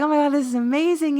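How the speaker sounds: delighted squeal of a bride-to-be; excited gush. Retold or imitated. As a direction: gush about the dress, quick and high. Dancing around in the wedding dress.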